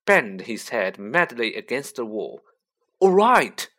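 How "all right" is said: loud, raised exclamation; sudden loud interjection; crying out. Acted. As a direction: cry out high to stop someone. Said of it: "cried Harry".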